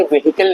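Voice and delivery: व्हीकल as ve-hi-kal; three-syllable व्हीकल with hi in the middle; common mispronunciation. Shown as a demonstration. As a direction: sound the h in व्हीकल. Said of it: "ये व्हीकल नहीं होता है".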